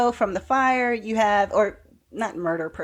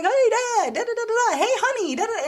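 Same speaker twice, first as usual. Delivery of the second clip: much higher, bright chirpy son voice, playing it up; oblivious cheerful son; 13 semitones higher, faster than usual. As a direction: chirp it out like a clueless happy son. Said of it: "He's like".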